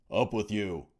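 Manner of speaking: loud barked order. A narrator's reading. Guard hauling Adrian up, a loud bark. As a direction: bark it at the prisoner. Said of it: "the voice barked".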